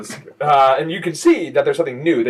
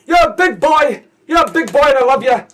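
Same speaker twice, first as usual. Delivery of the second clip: high, loud doting mom voice; clingy mother; 11 semitones higher, 8 dB louder than usual. Acted. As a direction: smother him like a teary mom.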